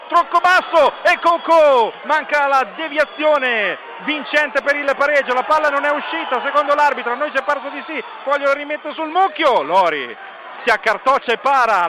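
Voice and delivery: high, loud near-miss call; rising alarm on the chance; excited commentary. Real, not acted.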